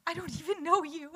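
high, disbelieving retort; flabbergasted, a stranger asking that; mock shocked reply; incredulous. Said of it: "this girl was like".

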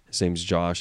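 ordinary talk

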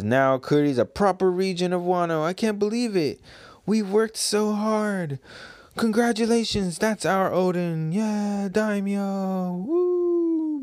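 high, giddy celebrating voices; joyful villagers. Acted. Kuri folk congratulating Odin on becoming Daimyo, high and giddy. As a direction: squeal it with pride, ending in a whoop. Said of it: "It says".

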